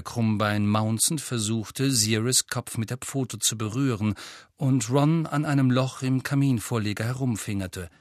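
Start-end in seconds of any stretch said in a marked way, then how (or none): none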